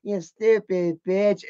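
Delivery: put-on drawn-out little-kid insult; four-year-old. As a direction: say it slowly like a small child. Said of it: "it sounded like a fucking four-year-old".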